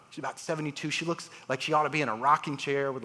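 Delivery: usual speaking voice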